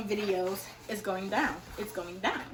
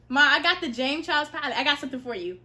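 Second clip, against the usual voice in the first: higher and loud; excited announcement; 7 semitones higher, 10 dB louder than usual. Real, not acted. Telling her mom about the palette, excited. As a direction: burst out with the news.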